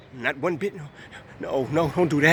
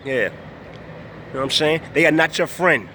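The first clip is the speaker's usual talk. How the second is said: ordinary talk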